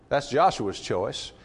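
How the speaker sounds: ordinary delivery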